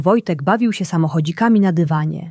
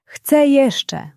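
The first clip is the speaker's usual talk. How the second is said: put-on much higher child's voice, calling for more; a child begging for more phone time; little boy calling; 9 semitones higher than usual. A narrator's reading. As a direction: whine for more like a small boy. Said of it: "wołał".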